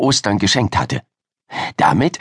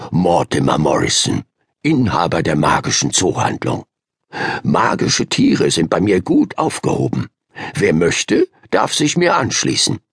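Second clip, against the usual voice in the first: low, steady voice of the magical pet shop owner; low, welcoming shopkeeper's call to the penguins; lower calm zoo keeper; 5 semitones lower than usual. A narrator's reading. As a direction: speak low and inviting. Mortimer addresses penguin colony.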